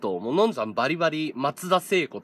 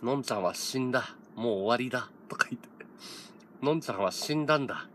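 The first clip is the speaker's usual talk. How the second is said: softer, a light mock of a gloomy murmur; imitating a despairing mumble; 3 semitones lower, 6 dB quieter, faster than usual. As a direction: mutter it to yourself like it's all over, half-joking. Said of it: "ずっとボソボソ言ってた".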